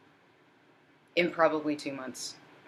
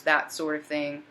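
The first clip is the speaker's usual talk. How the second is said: ordinary talk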